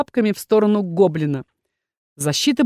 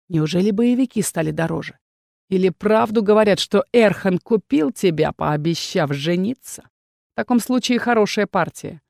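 a hollow, mocking murmur; muffled voice; 2 semitones lower than usual. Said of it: "его глухим голосом".

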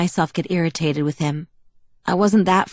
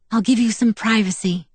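higher, lightly amused voice; amused, laughing; 5 semitones higher than usual. A narrator's reading. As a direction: say it with a touch of laughter. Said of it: "Alice laughed".

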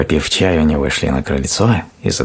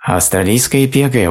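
important; 8 semitones higher than usual. A narrator's reading. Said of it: "важно сказал заяц".

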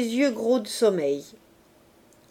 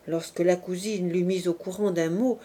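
ordinary delivery, nothing marked

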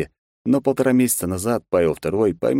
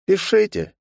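disappointed sigh; 12 semitones higher than usual. Acted. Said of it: "разочарованно вздохнул герцог".

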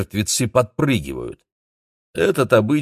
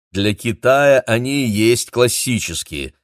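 slow, louder, with a chuckling smirk; wry amusement; 4 semitones higher, 5 dB louder than usual. A narrator's reading. Uncle explains Chinese vampires to a guest, wryly amused. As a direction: stretch it out with mild amusement. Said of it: "усмехнулся дядюшка Дэмин".

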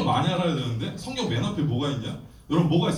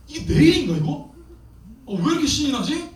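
excited; 8 semitones higher, 4 dB louder than usual. Retold or imitated. Thrilled during prayer meeting.

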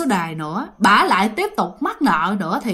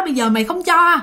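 mother yelling to guilt-trip her daughter; screaming threats; 5 semitones higher than usual. Retold or imitated. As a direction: wail it out as a desperate mother shouting at her daughter. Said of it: "bả mới la làng lên".

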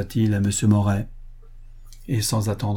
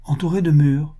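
higher, admiring question; admiring echo; 6 semitones higher, 4 dB louder than usual. A narrator's reading. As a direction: repeat it in mild wonder. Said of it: "fit Duplan avec admiration". (écho admiratif, plus aigu)

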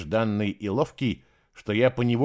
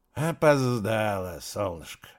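taunting; slower than usual. A narrator's reading. Drunk guard taunting her.

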